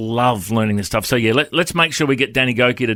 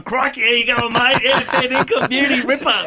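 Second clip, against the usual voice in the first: excited, high-pitched Aussie wildlife-man impression; hammed-up Aussie exclamations, pitched way up; Steve Irwin; 10 semitones higher than usual. Pastor mimicking his act abroad for the hosts, high hammed-up Aussie impression. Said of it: "I just act like Steve Wer-, Steve Irwin".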